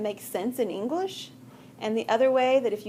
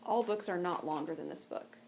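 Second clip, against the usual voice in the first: puzzled; 3 semitones lower, 7 dB quieter than usual. Retold or imitated. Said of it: "scratching your head and going".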